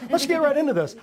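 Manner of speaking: fired-up call to get going. Real, not acted. Hypes up the start, repeated.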